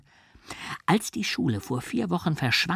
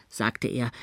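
ordinary delivery

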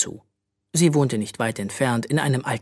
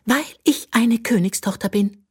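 high, lofty princess tone; haughty; 10 semitones higher than usual. A narrator's reading. Princess snubbing the other children, haughty. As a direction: look down your nose at her. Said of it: "entgegnete Magnesia hochnäsig".

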